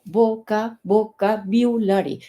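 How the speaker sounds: "vocabulary" slowly as bo-ka, bo-ka-biu-la-ri; syllable by syllable practice. Shown as a demonstration. Teacher telling learners to practise it, syllable by syllable. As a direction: drill "vocabulary" piece by piece, with biu. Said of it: "y practícalo".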